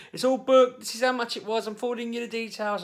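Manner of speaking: high, loud mock-excited announcement; high-pitched, hammed-up good news; excited wife. Acts out wife's breezy text.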